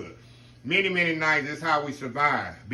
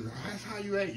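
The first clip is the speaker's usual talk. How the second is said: higher, lightly surprised mock question; a hint of a surprised stranger; mock surprise; 6 semitones higher, 10 dB quieter than usual. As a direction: imitate an outsider taken aback by the plate. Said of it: "A lot of people look at this".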